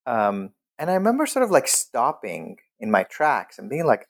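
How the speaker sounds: normal talking voice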